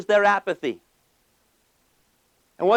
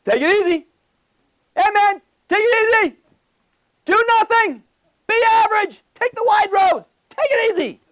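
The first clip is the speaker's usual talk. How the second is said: very high, hammed-up send-off; mock cheery farewell; 12 semitones higher, 4 dB louder than usual. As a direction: call out each slogan in an exaggerated high voice. Hammed-up mock common goodbye.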